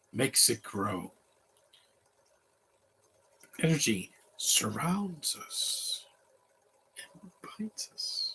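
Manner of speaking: slow, soft sage voice, lightly imitated; film-quote imitation. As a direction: fade towards a whisper like a hushed old master. Quoting Yoda along with film.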